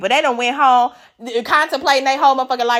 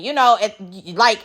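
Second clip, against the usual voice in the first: ordinary talk, nothing marked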